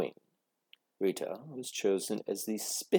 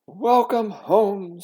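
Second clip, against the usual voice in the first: slow, loud, high sing-song welcome; drawn-out motherly welcome home; warm welcome; 11 semitones higher, 11 dB louder, much slower than usual. Acted. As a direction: greet her with open arms. Mother greets child warmly.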